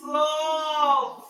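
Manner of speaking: The movie tough drawn out, slow and rough; tough-guy voice. Shown as a demonstration. Teacher acting out praise for an action movie, slow and rough. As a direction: growl it slowly like an action hero. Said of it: "If it's an action movie".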